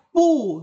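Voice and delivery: "pu" held without the upward glide; wrong tone on pu. Shown as a demonstration. Teacher warning against misreading, "pu" not rising. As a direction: say "pu" in its unchanged tone. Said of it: "Tidak dibaca".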